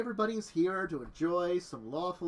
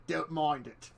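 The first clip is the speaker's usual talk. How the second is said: ordinary talk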